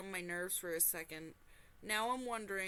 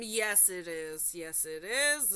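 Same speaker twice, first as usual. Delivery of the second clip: slow agreement; 2 semitones lower, 5 dB louder, slower than usual. Real, not acted. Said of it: "This is the stuff nightmares".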